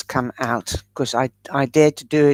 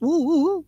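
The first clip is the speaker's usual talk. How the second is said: high, cheeky "woo-hoo-hoo"; high-pitched saucy hoot; playful ooh; 12 semitones higher than usual. Real, not acted. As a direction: coo it high and teasingly. Playful hoot after saying sexiness.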